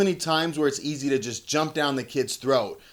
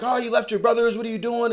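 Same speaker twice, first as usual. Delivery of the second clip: higher-pitched mock reproach; imagined scolding; 8 semitones higher, faster than usual. Dad imagining scolding his youngest at the dentist, mock reproach. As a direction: act out a fussing parent, voice raised in pitch.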